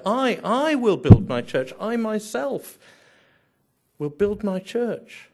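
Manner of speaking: "I" repeated, high and loud, insistent; stress on I. Shown as a demonstration. Preacher voicing Jesus telling Peter who builds, forceful stress on I. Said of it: "Jesus is telling Peter".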